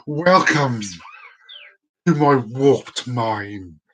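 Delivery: deep, slow, eerie delivery; drawn-out spooky welcome; spooky intro voice. Pretend. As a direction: intone it like a creepy late-night host.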